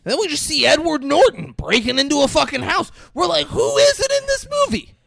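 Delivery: incredulous high shout; amazed shout. Real, not acted. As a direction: yell it at the screen in disbelief. Amazed at the star cast.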